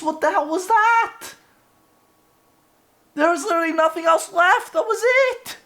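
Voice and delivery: high, pitch climbing with indignation; outraged disbelief. Real, not acted. Streamer venting to chat about the empty stream, outraged disbelief.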